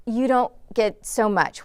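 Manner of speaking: usual speaking voice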